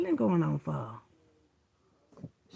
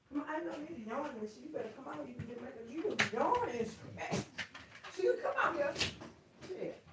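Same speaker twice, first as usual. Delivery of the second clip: high pet talk; 6 semitones higher, 8 dB quieter than usual. Real, not acted.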